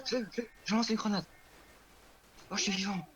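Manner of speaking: high excited stammer; rushed, thrilled game callout. Real, not acted. Throws grenade amid chaos. (voix excitée et aiguë)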